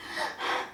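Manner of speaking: sharp, voiceless gasps for air; frantic breathless gasp; panicked gasps. Retold or imitated. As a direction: gasp like you're panicking in the water. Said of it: "I'm, like, breathing like".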